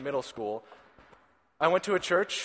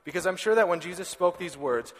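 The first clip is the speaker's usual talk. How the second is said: normal talking voice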